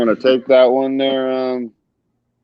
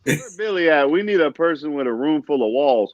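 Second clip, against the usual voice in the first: ordinary talk, nothing marked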